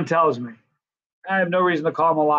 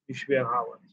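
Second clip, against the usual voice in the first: echoed again, softer and trailing off; fading echo of other fans; softer repeat; 4 semitones lower, 8 dB quieter, faster than usual. Retold or imitated. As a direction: let the repeat fade like a distant chorus. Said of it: "For every time somebody has said".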